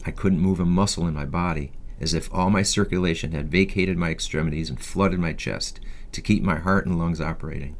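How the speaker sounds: ordinary delivery, nothing marked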